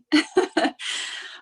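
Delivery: high breathy laugh through tears; emotional giggle; teary laugh. Real, not acted. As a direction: laugh while welling up. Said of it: "I'm literally crying over here".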